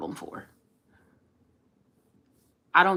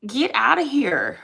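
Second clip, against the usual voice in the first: loud, drawn-out, higher brush-off; dismissive; 6 semitones higher, 6 dB louder, slower than usual. Retold or imitated. Telling off a troll in her retelling, dismissive. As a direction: wave them away with a slow, raised voice.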